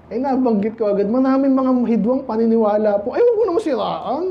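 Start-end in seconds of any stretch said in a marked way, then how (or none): 3.2-4.2 high, whiny imitation of a listener protesting; lightly mocking high plea. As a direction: play the offended churchgoer pleading, pitched up.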